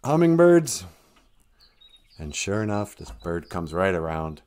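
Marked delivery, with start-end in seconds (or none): 0.0-0.9 high, loud call to the birds. Retold or imitated. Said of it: "And he goes".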